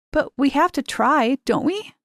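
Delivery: rising, pleading question. A narrator's reading. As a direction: push back, hoping she'll agree. Kate pleads against Geraldine's refusal.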